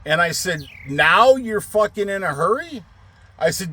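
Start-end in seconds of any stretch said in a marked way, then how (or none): 0.9-2.8 high-pitched sarcastic disbelief; retold irritated question, pitched up; incredulous retort. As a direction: throw it back at the driver, exasperated.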